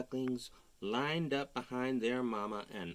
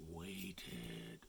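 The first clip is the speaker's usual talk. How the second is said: soft suspense; hushed; 11 semitones lower, 12 dB quieter than usual. A narrator's reading. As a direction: let it hang quietly. Suspense in read-aloud story.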